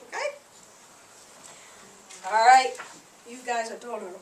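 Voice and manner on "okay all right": ordinary talk, nothing marked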